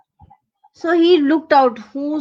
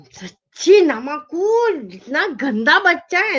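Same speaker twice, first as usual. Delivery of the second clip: fast, higher-pitched telling-off; indignant scolding; 3 semitones higher, much faster than usual. Acted. Startled grandmother scolding Ajay, indignant and quick. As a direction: scold the naughty child in a rush.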